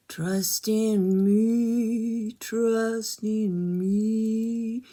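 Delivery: very slow, drawn-out hypnotic snake voice; slow, stretched singsong snake imitation. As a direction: croon it slowly like a snake lulling its prey. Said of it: "the snake from Jungle Book".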